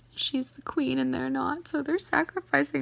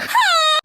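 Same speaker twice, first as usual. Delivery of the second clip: high, loud wail of frustration; exasperated scream; 11 dB louder than usual. Real, not acted. Exasperated outburst at the characters.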